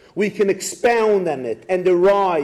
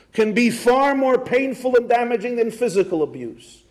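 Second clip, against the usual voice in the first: normal talking voice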